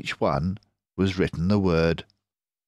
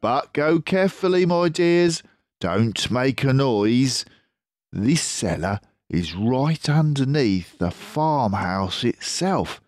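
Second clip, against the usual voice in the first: higher fox voice, urging care; cautious Mister Fox; 8 semitones higher, 3 dB louder than usual. Acted. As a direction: warn them gently.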